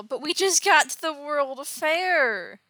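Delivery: high, drawn-out complaint; whiny protest. Retold or imitated. Dick objects to leaving the fair, whiny protest. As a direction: protest like a let-down sidekick, stretching the words.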